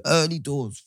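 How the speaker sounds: ordinary talk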